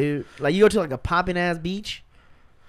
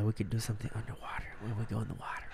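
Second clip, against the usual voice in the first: seductive whisper, much softer; 8 semitones lower, 15 dB quieter than usual.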